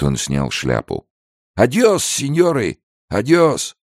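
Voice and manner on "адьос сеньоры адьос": high, called-out goodbye; old trader's farewell. Acted. Old trader takes leave of the guards, calling high.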